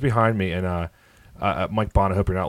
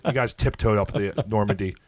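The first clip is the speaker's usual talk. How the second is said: ordinary delivery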